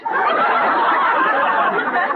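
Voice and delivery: high burst of laughter; laughing at her friend's joke. Acted.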